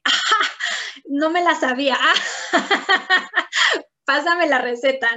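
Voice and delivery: laughing through the words, real amusement; high bursts of real laughter. Real, not acted. Laughing at the kid's trick.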